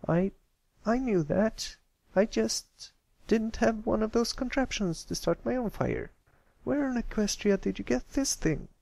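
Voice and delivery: high, brash filly bluffing; defensive tomboy filly. Acted. Scootaloo pretending she knew it all along, high and brash. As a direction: cover up being impressed.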